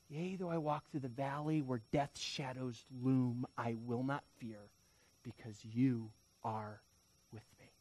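soft, low scripture, fading at the end; hushed psalm recitation. Acted. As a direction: recite the verse as quiet comfort. Psalm repeated as quiet comfort.

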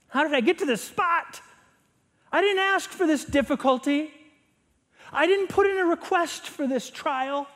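high, loud protest with pauses between sentences; indignant complaint. Acted. Someone wondering to themselves in hard times, high indignant complaint. Voicing a questioning, complaining believer.

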